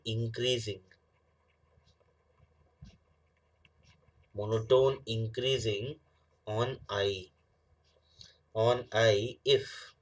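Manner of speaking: slow dictation with long pauses. Real, not acted. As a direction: dictate it slowly, phrase by phrase. Dictates definition while writing. (धीरे-धीरे लिखवाते हुए)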